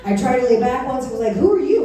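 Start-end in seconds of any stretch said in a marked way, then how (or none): 1.4-1.8 high, loud startled challenge; mock indignant surprise. Mattress to its owner lying on her back, mock indignant surprise. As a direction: challenge a stranger, comically affronted.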